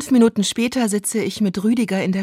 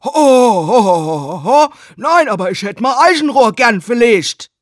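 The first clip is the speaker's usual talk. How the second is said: much louder, higher ho-ho belly laugh; loud hammy laugh then leering line; booming mock laugh; 4 semitones higher, 10 dB louder than usual. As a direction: guffaw like a cheesy porn workman.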